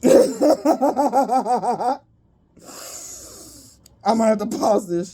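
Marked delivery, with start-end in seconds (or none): 0.1-2.0 high, rising wheeze of laughter; breathless laughing. Real, not acted. Reader who can't breathe from laughing, wheezing laughter. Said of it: "I can't breathe".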